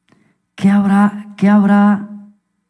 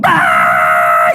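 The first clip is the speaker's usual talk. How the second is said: loud, drawn-out bawl; mock infant wail; imitated baby cry; 3 dB louder than usual. Y sigue llorando otra vez Moisés.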